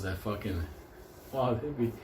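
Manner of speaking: ordinary talk, nothing marked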